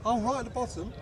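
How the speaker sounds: normal talking voice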